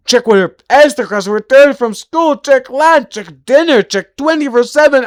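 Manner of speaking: a mother juggling everything at once; frantic housewife. Acted. Mother voiced in frantic monologue.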